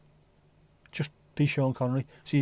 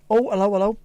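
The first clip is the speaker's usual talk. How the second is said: high, loud doting hello to the cat; pet greeting; 7 semitones higher, 5 dB louder than usual. Real, not acted. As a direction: coo it up at the returning cat.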